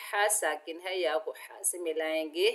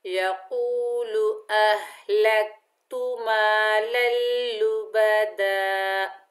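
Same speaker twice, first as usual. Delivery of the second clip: slow, drawn-out chanted recitation; Quran recitation; 3 semitones higher, 4 dB louder, much slower than usual. Shown as a demonstration. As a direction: recite it slowly with tajweed. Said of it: "next पढ़िए".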